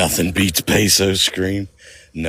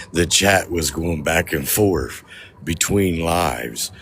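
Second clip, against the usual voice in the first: ordinary delivery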